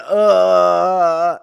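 loud, high stuck "uh"; hammed-up freeze instead of answering; frozen "uh". Recruit freezes on a radio code, loud high stuck uh. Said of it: "instead of".

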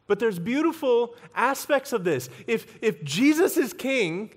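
preacher lifting the crowd at the climax; impassioned preaching. Acted. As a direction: proclaim it with joyful fervour. Climax of sermon on kingdom.

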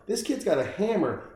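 ordinary talk

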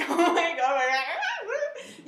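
high, loud fangirl squeal with laughter; mock gushing excitement. Shown as a demonstration. Showing how she didn't answer the waitress, mock gushing excitement. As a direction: overdo the excitement as a parody. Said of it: "I wasn't like".

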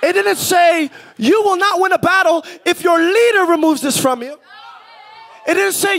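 high, full-voice preacher's shout; shouted preaching. Acted. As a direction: drive it home to the whole church, shouting.

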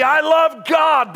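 imitating a churchgoer's loud protest; mock protest. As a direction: shout the claim out slowly. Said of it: "You can tell me".

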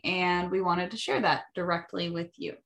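usual speaking voice

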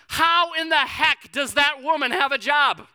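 slow, high mock outrage; retold anger. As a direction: punch it out slowly in exaggerated indignation. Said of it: "Then it turned to anger".

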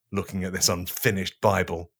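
ordinary talk

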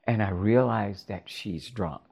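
ordinary talk

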